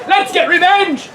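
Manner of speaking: high, slow dramatic rallying call; rallying cry. Acted. Heroine rallying the exiled princes, high dramatic cry. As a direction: draw out a high call to arms.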